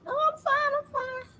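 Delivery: very high, thin tearful imitation; acted tearful. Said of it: "I've been crying my eyes out".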